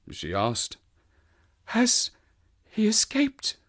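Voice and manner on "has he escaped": high, whispery anxious question; weak whisper. Acted. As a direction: breathe it out faintly, barely revived. Fainted woman asks anxiously.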